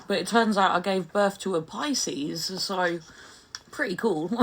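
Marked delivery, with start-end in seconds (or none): none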